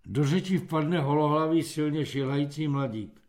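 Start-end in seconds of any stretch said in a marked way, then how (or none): none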